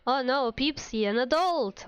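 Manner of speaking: ordinary talk, nothing marked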